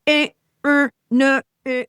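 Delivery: dismissive grunts. Retold or imitated. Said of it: "I just go".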